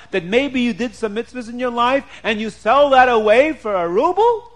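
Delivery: acted outrage, rising high and loud; indignant scolding with incredulous questions; upset, angry wife. A narrator's reading. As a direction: start stunned, then scold in a high, loud voice. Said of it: "Is she angry at him?".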